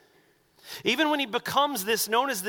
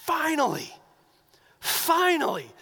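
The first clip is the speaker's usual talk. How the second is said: higher, eager cry of finally; excited relief; 8 semitones higher than usual. Retold or imitated. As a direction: burst out with long-awaited joy. Disciples excited Jesus claims kingship.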